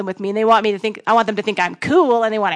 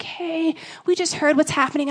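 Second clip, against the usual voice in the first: soft, higher, careful condolence; gentle sympathy; 4 semitones higher, 4 dB quieter than usual. Retold or imitated. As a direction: imitate a tender, concerned caller. Acted mentor's wife's sympathetic phone call.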